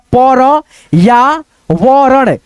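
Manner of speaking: slow, broken into separate syllables; teacher sounding out each syllable slowly. Shown as a demonstration. Teacher spelling out a word syllable by syllable for pupils. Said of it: "ऐसे करके हम लोग पढ़ेंगे".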